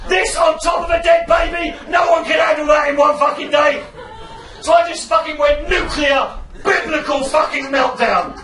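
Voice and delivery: shouting, high and furious; meltdown rant. Retold or imitated. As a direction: rant at full volume like a meltdown. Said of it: "I've turned into a death metal lead singer".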